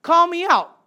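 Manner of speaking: high, loud eager call; loud excited Peter calling out. Acted. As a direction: call out boldly across the water. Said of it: "And Peter's just like".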